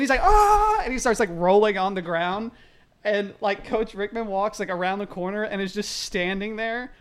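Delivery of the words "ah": high loud hammed-up scream; mock scream. Zach screaming on the locker room floor. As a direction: scream like you're going crazy. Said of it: "He's, like, screaming".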